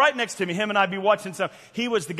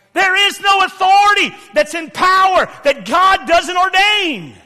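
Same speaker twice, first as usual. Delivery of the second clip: high, booming declaration from the pulpit; emphatic preaching shout; 11 semitones higher, 10 dB louder than usual. Real, not acted. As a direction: thunder it out word by word.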